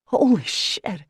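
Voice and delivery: joyful exclaim. Acted. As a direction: burst out with delight. Brother overjoyed at news.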